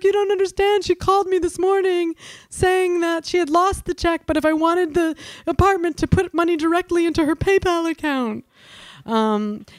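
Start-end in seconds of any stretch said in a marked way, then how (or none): 0.0-8.4 high, distressed caller voice; high upset woman. Retold or imitated. Caller voiced in retold story.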